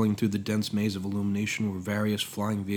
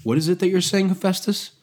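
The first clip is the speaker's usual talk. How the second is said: puzzled and raised, louder; perplexed question; 10 semitones higher, 6 dB louder than usual. Acted. Prometheus questioning his master, perplexed. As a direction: ask in baffled disbelief. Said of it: "he asked with perplexity".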